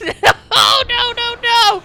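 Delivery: high, loud string of nos; real despairing cry. Real, not acted. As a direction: wail the nos in a row. Defeat again, repeated no.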